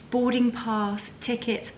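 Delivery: ordinary talk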